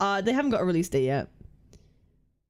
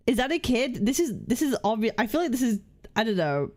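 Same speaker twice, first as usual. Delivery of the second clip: usual speaking voice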